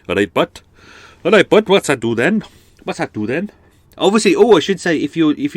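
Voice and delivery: high, loud Cardiff Welsh lilt; Welsh accent. Retold or imitated. Podcaster showing off his Welsh, Cardiff accent. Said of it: "quite a nice kind of Cardiff accent".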